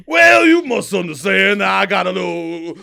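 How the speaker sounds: booming cartoon Southern bluster; Foghorn Leghorn. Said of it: "Foghorn Leghorn".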